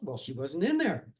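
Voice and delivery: plain reading voice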